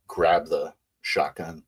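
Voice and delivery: ordinary talk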